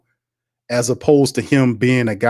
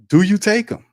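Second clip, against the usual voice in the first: pressed question; 10 semitones higher than usual. Acted.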